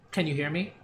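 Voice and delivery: normal talking voice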